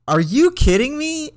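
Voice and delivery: high, disbelieving cry; shocked they just shrug it off; incredulous. Retold or imitated. Boss shrugs off near-fatal crash.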